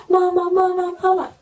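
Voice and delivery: put-on screaming child. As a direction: imitate a child screaming for mother. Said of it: "She's screaming".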